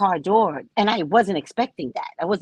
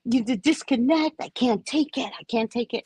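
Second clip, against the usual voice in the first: mocking his whiny phone lament; hammed-up overdramatic complaint, higher; playing up his drama about the phone; dramatic whining man; 7 semitones higher than usual. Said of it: "You're so dramatic".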